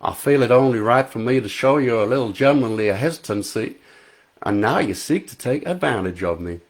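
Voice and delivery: put-on Southern US accent, mock gentlemanly; lightly hammed Southern US accent; faux Southern accent. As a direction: act the coy Southern belle in a Southern US accent. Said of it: "Daniel spoke in a faux Southern U S accent".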